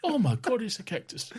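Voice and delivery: put-on old man voice. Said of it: "an old man".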